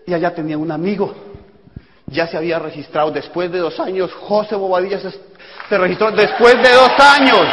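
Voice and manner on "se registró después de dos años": loud, slow, raised-pitch emphasis; emphatic exclaiming. Real, not acted. Speaker stressing the friend's late sign-up to audience, emphatic exclaiming. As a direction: build to a slow shout for the audience.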